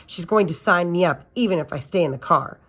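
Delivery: usual speaking voice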